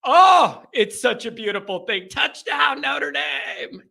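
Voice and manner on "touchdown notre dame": high, strained touchdown call; Tony Roberts impression. Host fondly recreating the Notre Dame call, announcer impersonation. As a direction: ring out the call, last word held. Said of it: "just the voice".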